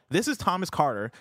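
normal talking voice

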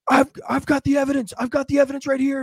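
high, stammering mock urgency; frantic victim. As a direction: act out a worked-up man insisting to police. Retold frantic victim running up.

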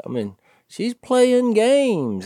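put-on high, slow, put-upon old man's whine; whining about his girlfriend's games; complaining old man.

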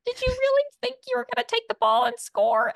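very high-pitched dog voice; Lenny. Acted. Host demonstrates the voice used on a guest, high-pitched dog voice. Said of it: "which is a very high-pitched".

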